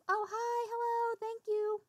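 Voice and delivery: bright, slow sing-song hello; high sing-song greeting. Real, not acted. Streamer greets chat sing-song.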